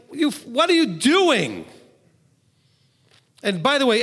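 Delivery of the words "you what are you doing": high, slow, exasperated challenge; loud rebuke. Retold or imitated. Paul confronting the Galatians in person, raised voice. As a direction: wake them up with it. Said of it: "he would have been like".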